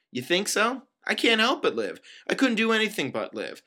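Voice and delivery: high-pitched, heated protest; indignant comeback. Acted. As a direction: argue back with the pitch pushed up. Heated comeback in acted argument.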